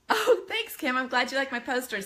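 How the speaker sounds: pleased surprise; delighted thanks. Real, not acted. Thanking a viewer.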